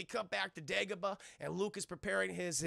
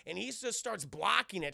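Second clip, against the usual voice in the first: ordinary delivery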